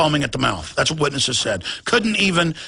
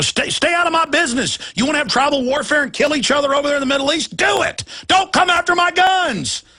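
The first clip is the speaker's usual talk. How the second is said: angry shouting; 7 semitones higher than usual. Real, not acted. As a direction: yell it at them in real anger. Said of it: "Okay, I'm sorry".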